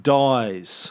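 Dies said to rhyme with "eyes"; long i in Dies, never "Dees"; corrected pronunciation. Shown as a demonstration. Host repeating what co-host told him, Dies with an eye vowel. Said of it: "You told me it's pronounced Dies".

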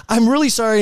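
mock apology. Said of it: "And, like".

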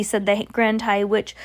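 plain reading voice

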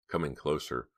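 ordinary delivery

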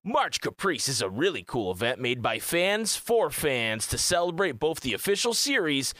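slow, drawn-out announcer ad read; super crazy commercial voice. Acted. Streamer reading an unpaid event blurb, hammy commercial voice. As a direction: read it like an over-the-top TV commercial. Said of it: "I'm gonna read it in, like, my super, like, crazy voice".

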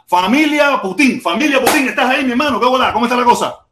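loud, high-pitched hail; calling out a greeting. Real, not acted. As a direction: hail the caller loudly and eagerly. Hailing a new caller onto the stream. (saludo a voces, alto y agudo)

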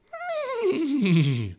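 high, descending horse's neigh; whinny like a horse; long, shrieking whinny falling in pitch. A narrator's reading. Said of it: "A horse's whinny echoed".

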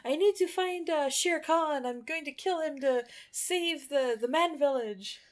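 put-on high, earnest boy voice; respectful Mowgli. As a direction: petition the great snake like a humble child. Mowgli petitions the great snake.

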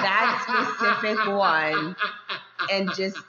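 slow, laughing emphasis. Real, not acted. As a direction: laugh while pleading. Laughing request to listeners.

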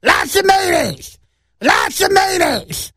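high, slow, strained shout of a demanding woman; shrill shrieking customer yelling for mayo; screaming lady. Retold or imitated.

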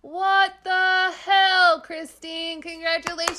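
high, loud, drawn-out celebration; excited cheering. Real, not acted. Host congratulating Christine on leaving, elated high cheer. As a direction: cheer her on at the top of your voice.

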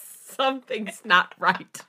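loud, slow hammed-up wail; mock lament. Reader keeps up the fake grief, loud and slow.

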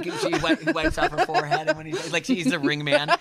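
high-pitched, talking through laughter; laughing. Real, not acted. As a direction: crack up while telling the bit. Joking about the pizza scene.

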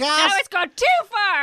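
high, loud, slow indignant yell; mock outraged supporter. Friend voices angry Trump fan, high indignant yell. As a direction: overdo the outrage. Voicing fed-up supporter, joke.

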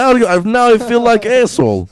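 loud, high-pitched comic Russian; sheepish captor in a hammed-up Russian accent; blustering Russian-accented apology; mock Russian accent. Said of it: "now you have Russian accent".

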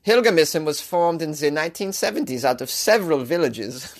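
put-on German-accented news read; bad German accent. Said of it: "This is the worst German accent".

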